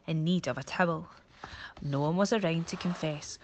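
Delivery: ordinary delivery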